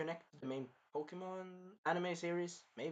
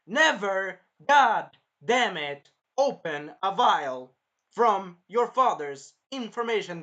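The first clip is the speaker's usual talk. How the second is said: loud, slow, every word punched; emphatic warning; 4 semitones higher, 11 dB louder, slower than usual. Real, not acted. Warning against the protagonist's stupid move, emphatic scolding. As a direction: hammer out each word like a stern rule.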